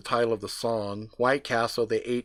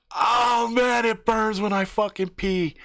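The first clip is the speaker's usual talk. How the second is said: high, loud yelp of burning pain; pained scream; 12 semitones higher, 5 dB louder, slower than usual. Acted. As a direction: scream it like it really burns. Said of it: "he screams out".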